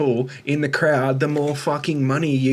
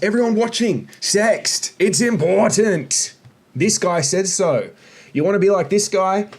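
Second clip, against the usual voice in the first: higher, emphatic mock proclamation; mock public announcement; 6 semitones higher, 3 dB louder than usual. Critic sarcastically urging everyone watching to sext, raised mock-proclaiming voice.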